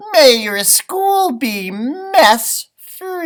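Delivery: high, slow, loud sing-song leprechaun. A narrator's reading. Leprechaun casting his tidy spell, high and drawn out. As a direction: cast the spell like a cheeky leprechaun. Leprechaun's rhyme in read story.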